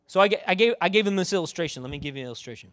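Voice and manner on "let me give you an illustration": ordinary delivery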